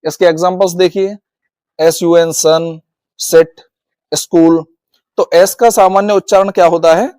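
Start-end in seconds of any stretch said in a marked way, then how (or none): 2.3-4.7 सन, सिट, स्कूल with a clear hissed s sound. Shown as a demonstration. Teacher showing the usual S sound, clear स in सन, सिट, स्कूल. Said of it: "एस को स बोला जाता है".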